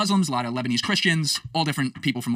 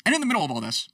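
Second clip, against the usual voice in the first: ordinary delivery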